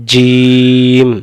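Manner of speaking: জিম held very long; slow drawn-out jim. Shown as a demonstration. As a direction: stretch জিম out slowly. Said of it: "এই অক্ষরের নাম". (জিম টেনে লম্বা করে)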